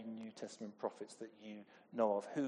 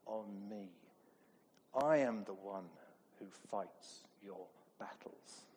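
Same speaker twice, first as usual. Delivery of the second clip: gentle reassurance; slower than usual. Retold or imitated. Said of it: "God is saying to us".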